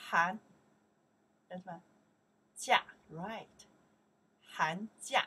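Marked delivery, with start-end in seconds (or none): 0.1-0.4 Han called out loud and clear; model pronunciation. Shown as a demonstration. Teacher modelling the word for the class to repeat, loudly. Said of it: "then your turn". 2.6-5.2 Han jia called loud with a rising jia; model pronunciation. Shown as a demonstration. Teacher leading the class drill, loud and clear. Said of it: "then your turn".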